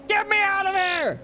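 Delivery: shrieking, high-pitched plea to escape; desperate high shout. Real, not acted. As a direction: yell it high like you're trapped. Fed up with the game area.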